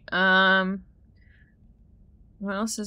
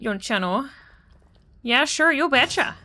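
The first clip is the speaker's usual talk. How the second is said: put-on a light, sing-song Midwest accent, higher pitched; 5 semitones higher than usual. Streamer jokes for chat in a hammed-up Midwest accent. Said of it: "That's my Midwest accent".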